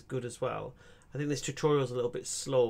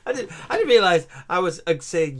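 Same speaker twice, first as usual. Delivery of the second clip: louder, still laughing; 3 semitones higher, 11 dB louder than usual. Real, not acted. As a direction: keep laughing while explaining. Laughing retelling the mistake.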